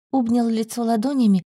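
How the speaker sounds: plain reading voice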